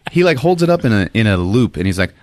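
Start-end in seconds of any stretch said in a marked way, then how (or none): none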